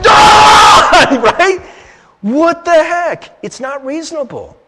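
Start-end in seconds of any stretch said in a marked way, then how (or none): 0.1-1.3 long, high, full-volume yell; explosive acted roar of frustration; raging yell. Acts out pounding the door. 2.2-3.4 high, loud, drawn-out exclamation; baffled outcry, each word slow; exasperated disbelief. Acted. Reacts to own outburst.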